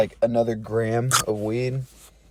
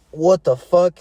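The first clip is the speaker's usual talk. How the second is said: higher, louder mock-appalled outburst; retold shock; 5 semitones higher, 5 dB louder, slower than usual. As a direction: imitate being hit by a horrible stink. Retold shock on smelling the jar.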